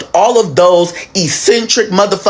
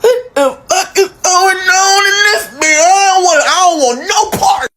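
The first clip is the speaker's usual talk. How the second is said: high, baffled outburst; bewildered; 9 semitones higher than usual. Retold or imitated. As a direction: throw it up high in confusion. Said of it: "I'm looking like".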